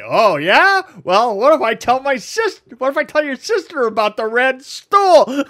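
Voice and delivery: loud, high-pitched teasing threat; mock threat. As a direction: imitate friends taunting with blackmail. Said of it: "would threaten me at different".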